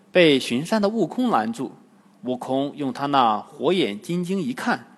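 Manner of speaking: usual speaking voice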